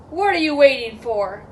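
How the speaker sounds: loud, bold dare; challenging big goat. Acted. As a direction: dare the troll to come on. Big goat dares the troll.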